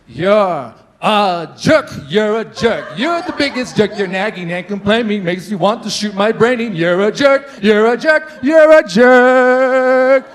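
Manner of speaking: loud, slow, sugary lilting tone; sweet sing-song insults. Shown as a demonstration. Boyfriend in an argument, calling her a jerk in a sweet sing-song. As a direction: sing the insults sweetly so she can't get mad. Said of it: "it's about the tone".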